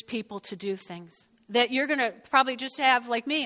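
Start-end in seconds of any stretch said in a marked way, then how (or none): none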